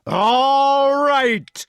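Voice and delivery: very high, loud, stretched bellow; drawn-out loud outburst; enraged giant. A narrator's reading. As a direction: bellow it, holding the vowel. Taunted giant loses his temper.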